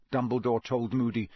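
ordinary delivery

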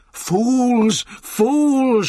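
high, loud, drawn-out cry; Benjamin's furious shout. A narrator's reading. Donkey berating the animals round the van, furious shout. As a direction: hurl each word out in outrage. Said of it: "shouted Benjamin".

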